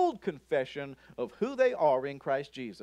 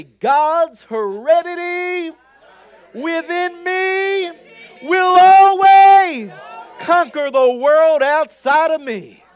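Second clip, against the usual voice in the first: slow, much higher and louder, shouting each phrase; shouted declaration; 10 semitones higher, 14 dB louder, slower than usual. Shown as a demonstration. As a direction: roar it out like you truly mean it. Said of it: "you gotta say it like you mean it".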